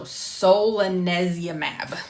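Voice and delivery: solanezumab slowly stretched out; careful pronunciation. Shown as a demonstration. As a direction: practise saying solanezumab, slow and deliberate. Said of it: "how to pronounce".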